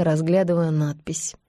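plain reading voice

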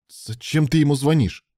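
suddenly losing patience; snapping in a temper. A narrator's reading. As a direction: lash out at him, rattled. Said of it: "психанул Илья".

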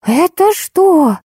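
very high, slow, stretched cry; long high-pitched howl of shock; drawn-out wail. A narrator's reading. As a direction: wail it out in acted horror. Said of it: "из её горла вырвался вопль".

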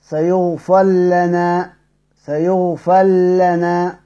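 slow tajweed demo with ra merged into lam; سيغفر لنا drawn out as sayaghfil-lana. Shown as a demonstration. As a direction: recite سيغفر لنا slowly, the ra assimilated into a doubled lam. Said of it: "يبقى كيف نقرأها؟".

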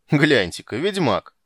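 brash guardswoman jeering loudly; loud mocking shout. A narrator's reading. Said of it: "сказала комендантша".

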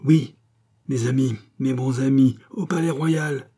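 high, loud pleading to the mob; a captive begging his captors, high and loud; desperate pleading. A narrator's reading. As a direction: placate the crowd in panic. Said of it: "répétait cet homme".

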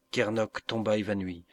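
ordinary delivery, nothing marked

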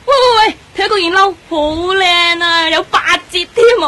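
excited shopping squeal. Acted. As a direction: squeal with delight at a discount jacket. Friend spots jacket on sale.

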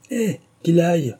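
high-pitched dismissive outburst; exclaiming. A narrator's reading. Squire scoffing as Jenkinson goes out, exclaiming. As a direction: throw it out, pitch jumping up. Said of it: "s'écria le squire".

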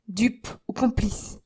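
slow, whispered threat; menacing whisper. Acted. Young man to concierge, slow menacing whisper. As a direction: hiss it slowly in his face. Accusation dropping to a hiss.

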